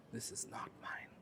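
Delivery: hushed, humble refusal; meek near-whisper. Acted. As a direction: say it very softly, almost voiceless. Honest woodcutter answers God again.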